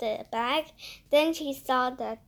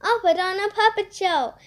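eager offer; 9 semitones higher, 11 dB louder, faster than usual. A narrator's reading. Eager offer, puppets waving.

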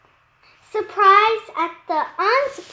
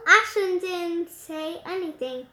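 usual speaking voice

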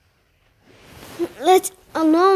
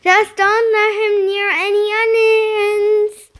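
high, loud, held-out call; loud warning; 7 semitones higher, 7 dB louder than usual. A narrator's reading. Father warning about the pig and onions, loud call.

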